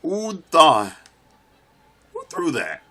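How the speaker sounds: loud, high, falling yell; outraged shout. Real, not acted. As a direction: demand the culprit in real outrage. Hit by thrown item in game.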